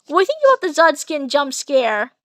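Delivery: ordinary delivery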